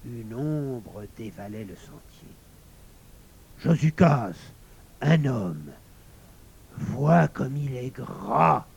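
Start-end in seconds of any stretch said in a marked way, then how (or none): 3.6-8.6 slow, loud, greedy emphasis; avid panting wolf. A narrator's reading. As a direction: point out prey, swelling on the last word. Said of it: "souffla-t-il, l'œil en feu". (loup avide qui souffle sa convoitise)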